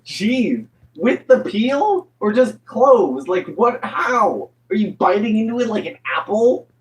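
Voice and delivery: high-pitched, disbelieving questioning; baffled, appalled amusement; incredulous. Real, not acted. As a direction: grill them in comic disbelief, voice pitched right up. Incredulous mock outrage at raw garlic.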